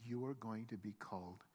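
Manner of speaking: low, soft, measured pronouncement; solemn renaming. Acted. Jesus solemnly renames Peter.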